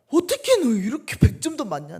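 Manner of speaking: high, loud astonished praise; a mother amazed at a perfect score. Retold or imitated. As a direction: marvel loudly at the kid. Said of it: "내가 막 이랬어요".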